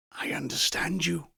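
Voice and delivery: hushed breathy whisper. Acted. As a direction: barely breathe the words. Scrooge answers the pointing ghost.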